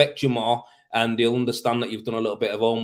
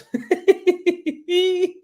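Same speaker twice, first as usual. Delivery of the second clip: bursts into a high-pitched laugh; loud high laugh; 4 dB louder than usual. Real, not acted. Amused by a viewer's pay question, loud high laugh.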